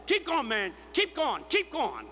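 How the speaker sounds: mock yell. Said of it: "stand up and yell that".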